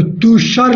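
तुषार with a short "a" in the last syllable, "tu-shar"; short-vowel misreading. Shown as a demonstration. Teacher shows students a wrong spelling's sound, तुषार with short last vowel. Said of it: "नहीं सही होगा".

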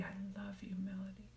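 soft, tender whisper to yourself; loving whisper. Shown as a demonstration. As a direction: whisper it like you mean it. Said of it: "If your name is Melody".

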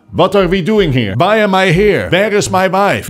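lost and confused, in a strong Dutch accent. Shown as a demonstration. Teacher acting a confused Dutchman, Dutch accent. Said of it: "If you're having trouble producing that G".

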